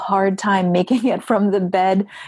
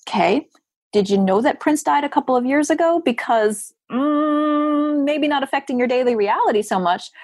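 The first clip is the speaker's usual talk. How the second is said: higher, wry inner sarcasm; skeptical inner voice; 7 semitones higher than usual. Retold or imitated. Judging a grieving [name] fan, with wry inner sarcasm. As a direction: think it dryly, eyebrow raised. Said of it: "I might be thinking".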